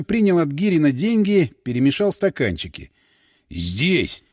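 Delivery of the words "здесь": spellbound. A narrator's reading. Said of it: "заворожённо сказал Иван".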